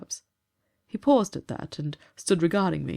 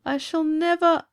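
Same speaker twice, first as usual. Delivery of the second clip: tearful ghost; 11 semitones higher, 5 dB louder than usual. Acted. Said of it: "began to sob and sob".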